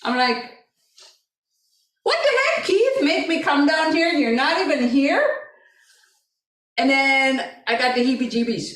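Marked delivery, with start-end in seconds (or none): 2.0-5.2 high-pitched retold scolding; indignant question to an absent son; exasperated mother. Mother scolding her missing son.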